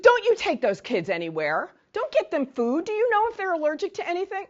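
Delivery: loud mock scolding, climbing high; vicious orders. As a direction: imitate a woman fiercely taking control of the kids. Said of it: "she was, like, vicious".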